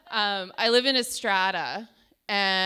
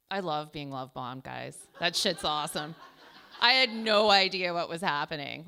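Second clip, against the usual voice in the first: ordinary delivery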